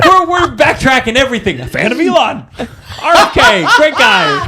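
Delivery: high, loud laughing mock praise; laughing sarcasm. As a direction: gush through laughter, clearly insincere.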